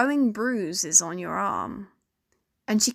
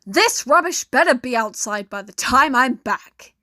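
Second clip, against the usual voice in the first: put-on louder, higher angry yell at the child; drunk father yelling; 3 semitones higher, 7 dB louder than usual. A narrator's reading. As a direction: bark the order like a drunk dad. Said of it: "Yelling about".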